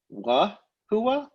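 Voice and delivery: "wa hua" with a clear W; W onset. Shown as a demonstration. Teacher contrasts W and vowel in "wa hua". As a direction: start "wa hua" with a rounded W glide. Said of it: "with a W".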